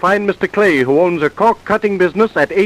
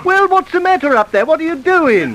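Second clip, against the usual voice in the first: calling up; 8 semitones higher than usual. Acted. Calling up to window.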